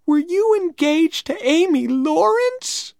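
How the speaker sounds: put-on very high, wounded girlish question; upset disbelief. A narrator's reading. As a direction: question him in high-pitched dismay. Becky upset, about to cry.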